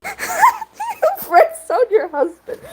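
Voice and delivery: high, slow laughing wail; mock wail. Real, not acted. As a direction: wail it out in comic disbelief. Exasperated at the story's twist.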